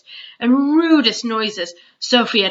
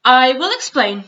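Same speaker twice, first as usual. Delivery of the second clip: giant explaining; 6 semitones higher, 6 dB louder than usual. A narrator's reading. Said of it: "the B F G said".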